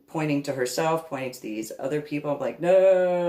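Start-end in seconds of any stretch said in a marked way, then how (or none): 2.6-3.3 loud refusal. Retold or imitated. Recoiling from the photo.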